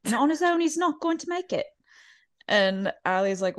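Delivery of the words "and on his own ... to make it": much higher, posh British matron voice; British accent, higher and grand; imitating Lisa's fancy accent. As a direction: purr it like a grand lady fussing over a colt. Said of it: "she's like".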